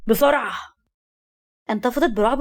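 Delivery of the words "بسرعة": understated raised-voice command; mild shouted order. A narrator's reading. Man ordering her to leave quickly, mildly shouted. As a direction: urge her out with a contained shout. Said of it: "فصرخ فيها وقال".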